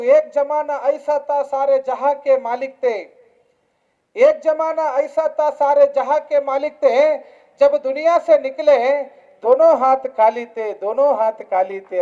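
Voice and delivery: ordinary delivery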